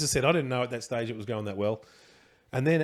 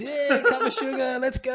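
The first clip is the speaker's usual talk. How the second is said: party cheer; 13 semitones higher, 5 dB louder than usual. Retold or imitated. Partygoers egging on the neighbour in a dress, rowdy cheer. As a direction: imitate a party crowd whooping along.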